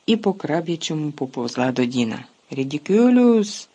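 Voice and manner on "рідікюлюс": loud, slow bellow of the spell; bawled. Acted. Dean facing the severed hand, bellowing. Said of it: "загорлав Дін".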